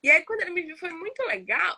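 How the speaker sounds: ordinary delivery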